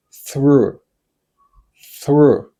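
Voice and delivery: "through" alone, one falling syllable; pronunciation demo. Shown as a demonstration. Teacher inviting learners to copy, "through" modelled slowly. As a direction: say "through" clearly for someone to copy. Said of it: "pronounce this word like me".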